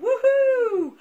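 loud high woohoo; joyful cheer. Real, not acted. New year cheer to kids.

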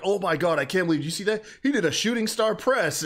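high, loud wrestling commentator call; mock commentator hype. Imagining commentary on the missed move, high loud amazed call. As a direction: hype it up like a stunned announcer. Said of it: "The commentary could have been like".

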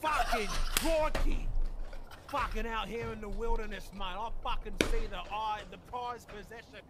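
mock Australian wildlife host; lightly voiced Aussie croc hunter, mate; Australian accent. Nature-show hunter bit, "mate.

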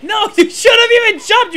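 high, loud protest with a laugh; exasperated scolding. Real, not acted. Player to friend who jumped, exasperated scolding. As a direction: scold a friend's bad jump, half amused.

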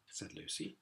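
plain reading voice

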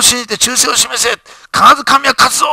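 王が兵を鼓舞する叫び; rallying shout. Acted. King rallies troops before battle. (力強く叫ぶ)

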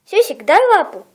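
loud dog command, stress on "дай"; firm call to the dog; talking to a dog. Real, not acted.

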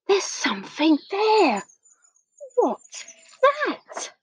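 high, wary little voice; nervous Eddie. A narrator's reading. Storyteller voices Eddie spotting a shape in the dark, nervous. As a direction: pitch it up, uneasy at what's there.

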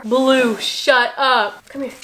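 ordinary talk

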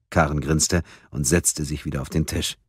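usual speaking voice

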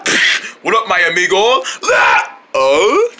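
normal talking voice

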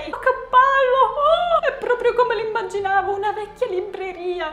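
very high, bright exclamations of wonder; high excited oohs at the shop; thrilled. Real, not acted. Entering the bookshop thrilled.

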